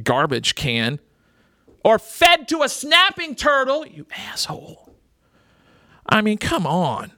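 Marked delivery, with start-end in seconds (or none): 1.8-3.9 high-pitched, incredulous outrage; indignant outburst. Real, not acted. Radio host ranting about animal dumpers, indignant. 3.9-5.0 whispered aside in disgust; muttered insult. Real, not acted. Host cursing an animal dumper, whispered.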